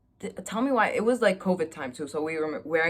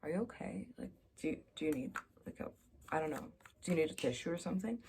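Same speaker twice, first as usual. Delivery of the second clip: hushed concerned asking; 11 dB quieter, much faster than usual. Retold or imitated. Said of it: "I'm like".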